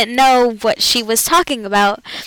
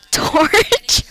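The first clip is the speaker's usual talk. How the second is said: high, laughing squeak of a guess; mock high blurt; 10 semitones higher than usual. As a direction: squeal the guess out, amused at the class. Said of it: "people kept saying things like".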